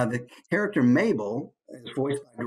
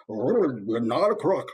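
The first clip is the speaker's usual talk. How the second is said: slow, blubbery politician impression; jowly, drawn-out wobble on the words; Richard Nixon; 2 semitones lower, slower than usual. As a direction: blubber it out slowly. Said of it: "Richard Nixon impression".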